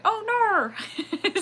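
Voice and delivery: high-pitched mock Australian "oh no"; Australian accent. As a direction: put on a silly, exaggerated Australian accent. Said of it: "like, an Australian going".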